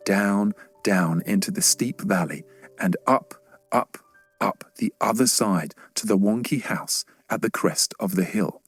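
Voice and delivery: ordinary delivery, nothing marked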